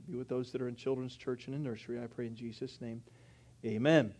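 soft, hushed opening prayer. Acted. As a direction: pray quietly and earnestly to God. Pastor prays before sermon.

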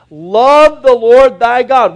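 slow, loud, drawn-out "Love the Lord thy God"; emphatic command. Acted. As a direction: lean on every word like God's own command. God's command voiced at its climax.

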